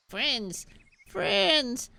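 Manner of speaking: high, squeaky little dragon; tiny excited dragon calling; baby dragon voice. Acted. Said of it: "a baby dragon voice for Draggy".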